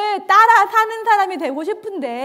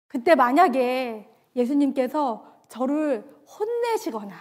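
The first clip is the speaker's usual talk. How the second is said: ordinary talk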